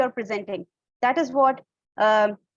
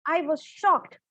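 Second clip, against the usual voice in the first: shocked, said higher in pitch; 4 semitones higher, slower than usual. Shown as a demonstration. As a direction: demonstrate shock with a raised pitch. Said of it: "talking at a high pitch, like when you are showing shock".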